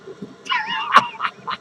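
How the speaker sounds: high-pitched cackle; high, squealing burst of laughter; cackling laugh. Acted. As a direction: cackle high at your own joke. Laughs at own joke.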